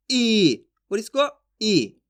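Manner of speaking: short ई, then ई held long and slow. Shown as a demonstration. Teacher contrasting both vowels slowly for students. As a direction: contrast a clipped ई with a stretched ई. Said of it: "इसको खींच के पढ़ेंगे".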